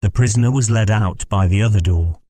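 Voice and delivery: plain reading voice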